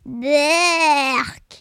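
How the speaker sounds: long drawn-out yuck; revolted, stretched groan of a word; grimacing disgust. A narrator's reading. Pierre hearing the witch's soup ingredients, disgusted.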